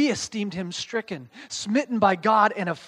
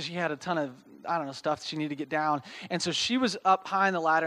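ordinary talk, nothing marked

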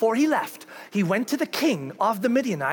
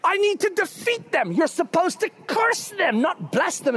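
high, loud outraged protest; a king scolding his prophet; king berating Balaam, outraged; irate king; 12 semitones higher, 4 dB louder than usual. Retold or imitated. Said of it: "and the king's like".

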